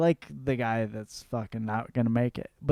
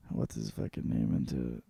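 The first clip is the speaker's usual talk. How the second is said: ordinary delivery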